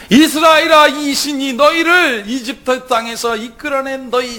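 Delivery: loud, high, slow proclamation; a people's rapturous shout; crowd shouting. Acted. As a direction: cry it out to the idol. People cry out to golden calf.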